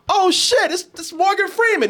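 hammed-up fan excitement; mock excited surprise. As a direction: act starstruck at spotting a movie star. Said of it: "it's not like".

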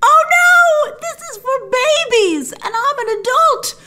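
high, loud squeal of mock horror; mock panicked shout. To the viewers, a hammed-up horrified shriek. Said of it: "I was like".

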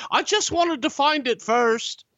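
very high, protesting English gentleman; Alfred impression. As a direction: imitate an indignant Englishman defending himself. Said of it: "He goes".

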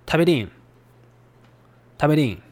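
hometown Mikawa "Taberin"; Mikawa dialect. Shown as a demonstration. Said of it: "in Mikawa dialect".

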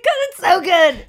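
high, loud giggling glee; giggly glee. Retold or imitated. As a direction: gush with delight before the punchline. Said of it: "I'm like".

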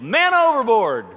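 loud, high shout of man overboard; husband shouting from the water to his wife; yelling. Retold or imitated. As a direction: bellow it across the water. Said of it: "and yelled".

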